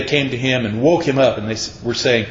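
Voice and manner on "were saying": usual speaking voice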